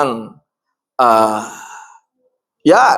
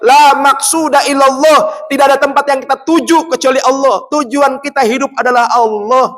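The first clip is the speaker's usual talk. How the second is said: preaching at full force; 9 semitones higher than usual. Acted. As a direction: lift it up and proclaim to the crowd. (khotbah lantang penuh semangat)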